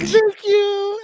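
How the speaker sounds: very high, loud mock female squeal; imitated flattered woman. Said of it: "And she's like".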